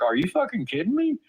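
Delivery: high-pitched, disbelieving retort; indignant, incredulous questioning. Retold or imitated. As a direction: retell your disbelief, pitch leaping up. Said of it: "I just was incredulous".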